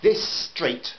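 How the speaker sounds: mother spitting angry words at the neighbour; spat out. A narrator's reading. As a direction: spit the words at him. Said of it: "Joanne spat out the words".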